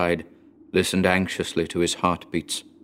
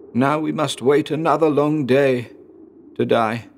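higher-pitched, resigned complaint; despairing son; 6 semitones higher than usual. A narrator's reading. Son to dying father, in higher-pitched despair. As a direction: voice the son facing another wait for death. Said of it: "said Dmitri".

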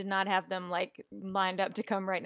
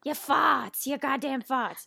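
movie line imitation; 6 semitones higher, 4 dB louder, slower than usual. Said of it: "that is a line in the movie".

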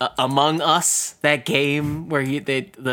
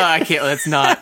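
usual speaking voice

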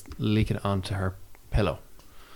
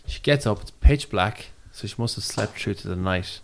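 ordinary delivery

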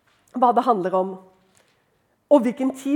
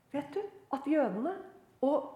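usual speaking voice

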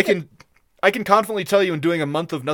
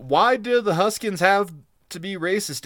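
ordinary talk

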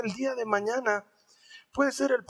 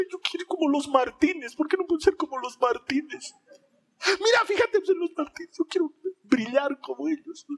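higher, fast whiny complaint with a mock sob; whiny parent; 6 semitones higher than usual. Frustrated parent whining about the perfect family, mock tearful. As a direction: whine like a tired, jealous parent.

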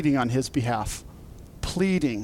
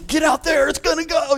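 high, hurried call to run out; urgent excited call; 11 semitones higher than usual. Retold or imitated. Husband urging his wife to run out, high and hurried. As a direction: hurry her along before it erupts. Rushing to see Old Faithful.

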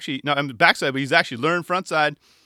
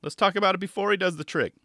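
much higher, cheery hype voice; much higher cheery mock commentator; mock overeager commentator; 7 semitones higher than usual. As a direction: whip up fake excitement. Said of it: "Alex was like".

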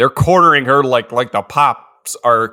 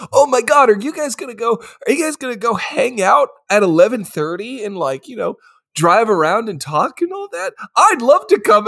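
eager tagalong friend; 7 semitones higher than usual. Retold or imitated. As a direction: beg to be invited like an overexcited friend. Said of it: "that friend who's like".

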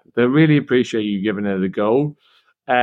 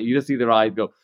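ordinary delivery

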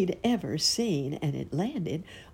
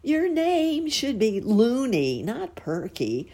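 louder, slow taunting worm; scornful earthworm taunt, louder; mocking retort; 10 semitones higher, 5 dB louder, slower than usual. A narrator's reading. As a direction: jeer at the dreamer. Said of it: "Grubby shot back".

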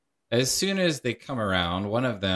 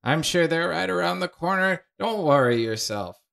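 higher, chirpy meddling ghost voice; pry kindly like a busybody aunt; nosy ghost; 10 semitones higher, faster than usual. Acted. Ghost consoling the paladin, high and chirpy.

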